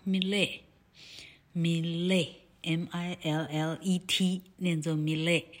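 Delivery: Millet said the French way, mee-LAY, silent T; 念作Millet with the final T dropped, stress on the last syllable; French pronunciation of Millet. Shown as a demonstration. Teacher correcting a painter's name, French pronunciation. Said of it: "字尾的T不发音".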